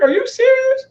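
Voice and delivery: can't believe it's still off; incredulous. Retold or imitated. Retelling his frustration with the Xbox.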